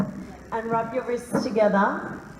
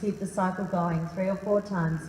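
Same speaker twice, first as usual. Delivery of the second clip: guided meditation; 5 semitones lower, 5 dB quieter than usual. Acted. Guiding the room through deep breathing, low and calm.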